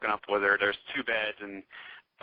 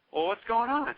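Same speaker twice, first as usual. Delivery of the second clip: higher, lightly voiced girl asking; mock high question; 10 semitones higher than usual. Meg asking the woman what the problem is, a mildly higher mock voice. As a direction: pitch it up like the worried girl.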